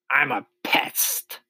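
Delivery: high, slow, grinning boast; proud announcement. A narrator's reading. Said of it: "grinning broadly and looking round the room for approval".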